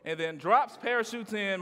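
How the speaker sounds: ordinary delivery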